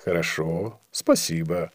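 low, soft, dignified thanks. A narrator's reading. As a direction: accept it quietly, with some pleasure and dignity. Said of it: "не без достоинства сказал".

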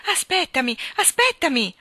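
high, loud urgent plea; pleading. A narrator's reading. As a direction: beg them to wait. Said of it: "lo pregò il re".

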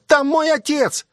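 put-on high, drawn-out cry of a desperate boy; child's high-pitched yell; boy shouting. A narrator's reading. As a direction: cry out slowly and high, pleading for father. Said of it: "закричал он".

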